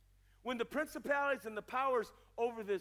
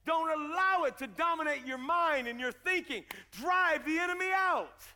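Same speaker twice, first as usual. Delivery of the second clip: higher, louder exhortation; forceful preaching; 6 semitones higher, 6 dB louder than usual. Acted. Preacher warning the church off this generation, forceful exhortation.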